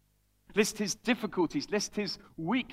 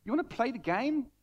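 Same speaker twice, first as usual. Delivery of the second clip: defiant Paul; 5 semitones higher than usual. Acted. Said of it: "He's saying".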